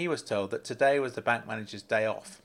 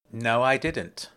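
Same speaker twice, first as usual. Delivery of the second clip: slow, firm and loud no; firm denial; 5 dB louder, much slower than usual. A narrator's reading. Grant firmly denying it to the bank manager, slow and loud. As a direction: plant the denial, Grant refusing to budge. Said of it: "Grant replied firmly".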